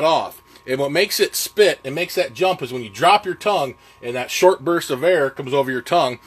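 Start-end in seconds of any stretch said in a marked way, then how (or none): none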